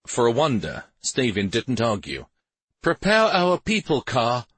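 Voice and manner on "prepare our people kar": higher-pitched firm command; giving an order. A narrator's reading. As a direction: order it out to your man. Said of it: "Stavin ordered".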